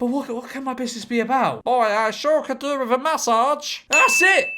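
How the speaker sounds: high-pitched wondering, leaping to excited discovery; hammed-up realisation. Stranded diver hits on business idea, mock excitement. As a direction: think aloud high, then burst out.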